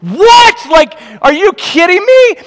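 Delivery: high, shouted astonishment; hammed-up, incredulous yell; acted disbelief. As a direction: screech it as if utterly floored. Retells his disbelief at their yes.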